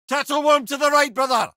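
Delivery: high, loud call to a comrade; combat warning shout. Acted. Warrior head warns his brother of a foe, loud high shout. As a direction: shout the warning over the fight.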